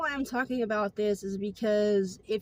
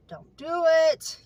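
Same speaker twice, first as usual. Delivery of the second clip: higher, louder, slow warning; urgent inner plea; 8 semitones higher, 7 dB louder, slower than usual. Retold or imitated. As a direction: drag it out as a pleading inner voice.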